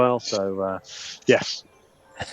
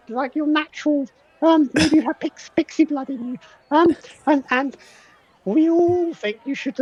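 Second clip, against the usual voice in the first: pixie voice. Acted. GM keeps voicing pixie.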